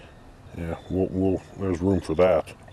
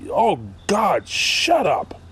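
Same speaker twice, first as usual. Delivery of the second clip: high, exasperated mock groan; a fed-up high-pitched brush-off; 11 semitones higher, slower than usual. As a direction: roll your eyes and squeal shut up. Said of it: "You get".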